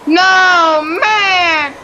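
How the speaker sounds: loud, high cry of frustration; angry outcry. Real, not acted. Gamer cries out at the blizzard, angry. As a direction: wail it out at the game. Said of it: "Damn, I got pissed off today".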